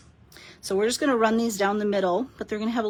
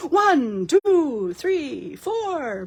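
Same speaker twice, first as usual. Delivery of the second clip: a hint of a counting vampire, louder on one and two; the Count from Sesame Street; 5 semitones higher, 4 dB louder, much slower than usual. Host counts paper pieces for viewers, lightly playing the Count. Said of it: "I feel like the count from Sesame Street".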